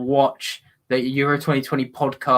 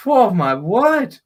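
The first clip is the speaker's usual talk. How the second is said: slow, drawn-out exclamation, raised pitch; astonished; 4 semitones higher, 3 dB louder, much slower than usual. Real, not acted. Commentator stunned by a seventeen-year-old's goal, astonished. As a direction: let the amazement stretch every word.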